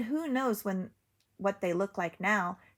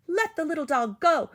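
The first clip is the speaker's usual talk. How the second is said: higher, loud scream; frightened cow screams; 8 semitones higher, 5 dB louder than usual. A narrator's reading. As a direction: yell at the wolf. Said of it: "Cow screamed".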